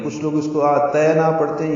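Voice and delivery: usual speaking voice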